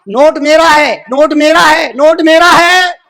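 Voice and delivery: high, insistent repeated cry; retold claim in a ringing preaching voice; declaimed claim. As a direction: proclaim each claim high, stretching the last.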